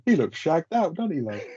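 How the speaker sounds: high, cheeky Mickey Pearce-style jibe; mock cheeky lad. Friend teasing Rodney on the bus, cheeky high jibe. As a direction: toss it off like a mate taking the mick. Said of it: "he's like".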